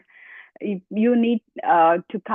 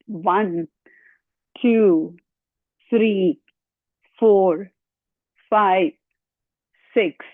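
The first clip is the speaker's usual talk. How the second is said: counting through the exercise; much slower than usual. Real, not acted. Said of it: "I'm out of breath".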